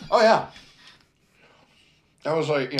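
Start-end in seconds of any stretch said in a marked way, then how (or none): 0.1-0.5 excited recognition. Real, not acted. Sudden recall of shared memory.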